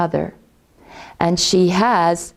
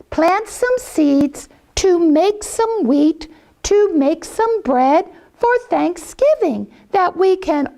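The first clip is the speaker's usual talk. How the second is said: high hen voice asking nicely; high, polite mother hen; 10 semitones higher than usual. Acted. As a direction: lift it up high and ask sweetly. Hen asks farm friends for help.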